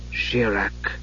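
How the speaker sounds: low, hushed spell word; whispered. A narrator's reading. Raistlin speaks magic to the staff, whispered. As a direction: breathe the word very softly. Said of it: "he whispered".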